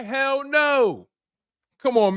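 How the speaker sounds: normal talking voice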